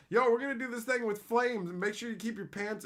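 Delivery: high-pitched hyped-up safety warning; mock hyped instruction. Imagined friend setting up a flame stunt, mock hype.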